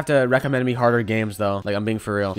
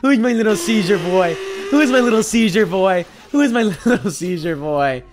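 high, louder cooing like to a pet; sing-song baby voice; baby talk; 7 semitones higher, 6 dB louder than usual. Pretend. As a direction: coo at it like a puppy. Cooing over ragdolled enemy.

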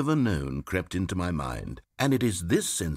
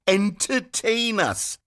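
slow, higher mocking demand; 8 semitones higher, slower than usual. Narrator mimics demanding players, slow sneering demand. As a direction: drag it out like a bored spoiled crowd. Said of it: "gnashed their teeth and said".

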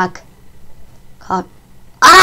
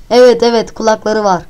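ordinary delivery

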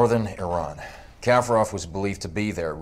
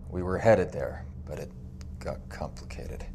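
very soft, near whisper; hushed admission; 4 semitones lower, 9 dB quieter than usual. Acted. Sergeant admitting the mission went wrong, hushed. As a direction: let it sink to a whisper.

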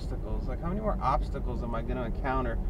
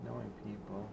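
much softer, low under-the-breath grumble; muttered aside; 7 semitones lower, 12 dB quieter, faster than usual. Real, not acted. Driver expecting no reply mutters to herself, soft and low. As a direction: say it half to yourself, resigned. Grumbling about unresponsive customers.

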